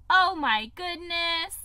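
slow, drawn-out exasperated oh my goodness; catchphrase. Retold or imitated. Nanny catchphrase the kids repeat, drawn out. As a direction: stretch it like a fed-up nanny. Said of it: "all my nanny kids ever will say".

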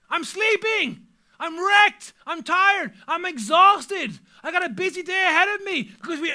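high, full-voiced irate shouting; hammed-up outraged yelling at whoever woke you; rudely woken outburst. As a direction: rant like an exhausted parent jolted awake. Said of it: "I'm usually not coherent for the first thirty seconds".

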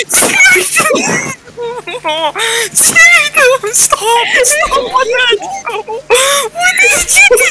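high, loud wailing shout; mock crying shout. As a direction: scream it high with hammed-up sobs. Character killed in game, distress.

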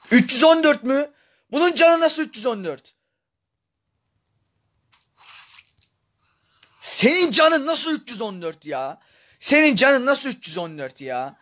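indignant disbelief at the boss's health; outraged disbelief. Real, not acted. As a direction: shout it in stunned outrage at the screen.

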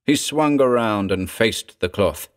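plain reading voice

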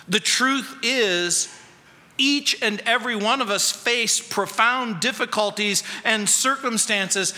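ordinary talk

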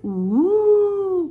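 long, loud wailing "ooh"; high swooping ghost "ooh"; ghostly cry "ooh". A narrator's reading. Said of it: "cried the little ghost".

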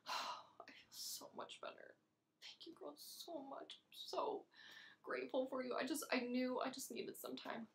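very soft, low and relaxed; soothed gratitude. Acted. Thanking her girlfriends once she feels better, soothed. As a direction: murmur thanks, all calmed down.

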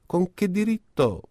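high indignant protest. A narrator's reading. Another neighbour protests back, indignant and high. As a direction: object sharply and high. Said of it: "protestava un altro".

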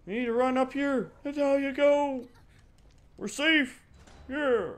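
high, loud rush of relief; breathless high yell of relief; excited shout. Real, not acted. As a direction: yell it out as you escape. Excited yelling while escaping.

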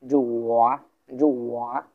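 "জো ওয়া" dipping down, then rising; teacher's dip-and-rise tone on "জো ওয়া"; third tone. Shown as a demonstration. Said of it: "উচ্চারণটা কেমন হবে".

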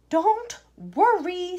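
high, loud, drawn-out reassurance; breezy reassurance. A narrator's reading. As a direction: stretch it out to soothe her. Wonka soothing screaming mother.

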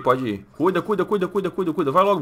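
ordinary talk, nothing marked

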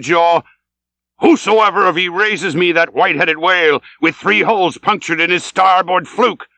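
high, building harangue to the crew; fervent captain's promise, slowing at the climax; rousing sea captain. Acted. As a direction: proclaim the reward to all hands. Ahab promises the gold.

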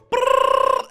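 high rolled buzzing noise; high bee buzz. Pretend. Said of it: "I don't think I can keep doing this".

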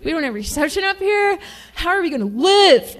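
hammed-up dismay at no phone signal; mock panic. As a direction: play a spoiled guest losing it over no Wi-Fi. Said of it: "I've heard a few go".

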